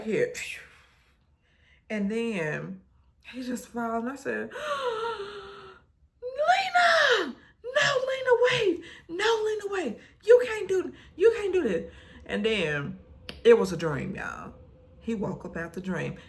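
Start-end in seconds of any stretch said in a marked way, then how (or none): 4.5-5.7 sharp high shocked gasp. Retold or imitated. Seeing him shot, a high shocked gasp. 6.2-11.9 high, loud sobbing shout; crying plea. Acted. Begging Lena, high loud sobbing shout.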